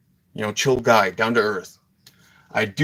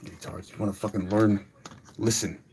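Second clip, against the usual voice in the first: much softer, bitter cursing under his breath; seething mutter; 2 semitones lower, 7 dB quieter than usual. Real, not acted. Angry teacher cursing Ty and his friends under his breath.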